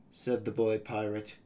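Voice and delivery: usual speaking voice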